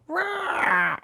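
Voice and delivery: a sudden, very high goblin screech; goblin shriek. Acted. Lone goblin screeching from the darkness, high shriek. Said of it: "another shriek is heard".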